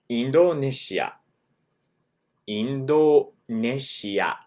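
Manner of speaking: Indonesia as in-do-ne-shi-a; インドネシア; Japanese Indoneshia. Shown as a demonstration. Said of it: "The country Indo".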